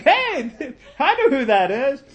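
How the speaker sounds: high, loud mock greeting; excited recognition. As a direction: call out as if spotting a friend. Acts out spotting a familiar runner.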